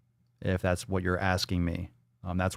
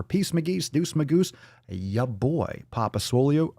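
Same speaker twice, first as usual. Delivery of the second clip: 1 catchphrase sign-off; 4 semitones higher than usual. Acted.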